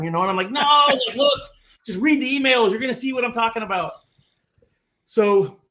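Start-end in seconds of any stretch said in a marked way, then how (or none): 0.5-3.9 high, loud, insistent protest; exasperated pleading. Retold or imitated. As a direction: act out begging your wife to hear you out. Acted pleading with wife.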